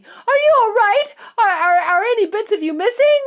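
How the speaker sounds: high, loud panicked questions; a mother terrified for her boy; frantic. A narrator's reading. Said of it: "cried Missus Teavee".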